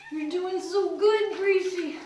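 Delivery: high, bright praise for the cat; encouraging praise. Real, not acted. Owner praises Gracie during shampoo, high encouraging.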